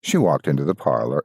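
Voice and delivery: plain reading voice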